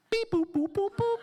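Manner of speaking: high-pitched spoken dialling tones; imitated phone beeps. Son phoning Carol for help, mimicking dial tones.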